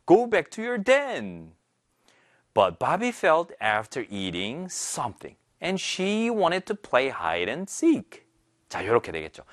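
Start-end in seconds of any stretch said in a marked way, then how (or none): 0.1-1.5 very slow, careful English reading; slow read-aloud model. Shown as a demonstration. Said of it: "자, 한번 보도록 할게요". 2.5-8.2 very slow, careful English narration; slow narration demo. Shown as a demonstration. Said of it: "자, 한번 보도록 할게요".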